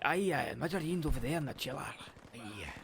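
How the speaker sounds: a hint of a broad Scottish accent, lightly put on; Scottish shop worker. Friend retelling the shop, voicing the Scottish dude in Scottish accent. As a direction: answer gruffly as a Scottish worker. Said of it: "A proper Scottish dude".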